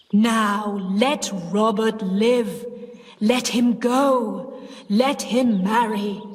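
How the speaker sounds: slow, commanding ghostly voice; deep ghost voice. Acted. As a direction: order her like the dead. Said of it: "Sis said in the deep voice".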